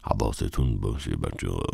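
low, soft warning under breath; quiet low aside to the kids; whisper. Acted. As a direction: hush the kids so the ladies miss it. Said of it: "ساکس نجوا کرد".

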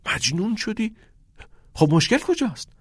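high, incredulous disbelief; shocked friend asking; surprised. A narrator's reading.

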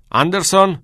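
high, loud summons; shouted name. A narrator's reading. Old teacher summoning a waiting pupil, shouting. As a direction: shout the surname across the hall. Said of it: "دکتر هگر فریاد زد".

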